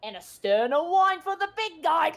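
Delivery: high, loud, excitable serving chatter; busy server Kiwi. Acted. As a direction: hand out drinks in a high, eager voice, building to a shout. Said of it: "you also see Kiwi serving drinks".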